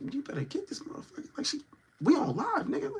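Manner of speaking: urgent hushed aside, mostly whispered; re-enacted covert warning under the breath. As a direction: whisper it secretly off to the side. Re-enacts covert warning beside camera.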